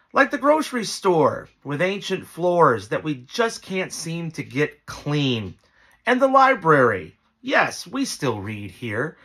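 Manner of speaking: emphatic recital, pitch leaping up and falling with each phrase; poetry reading. Acted. Small-town local addressing a lost visitor, dramatic poem recital. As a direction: read the poem out with punched openings and deliberate pauses. Reads own poem aloud.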